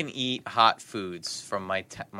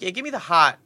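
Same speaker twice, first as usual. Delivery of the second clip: louder, higher eager order; 9 semitones higher, 7 dB louder than usual. Retold or imitated. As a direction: demand it with gusto. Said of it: "I'm like".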